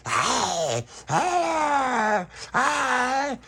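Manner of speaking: high, crying hey; crying vampire calls. Retold or imitated. Tony catching Stella, high wailing calls. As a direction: wail each hey up high. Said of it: "like that".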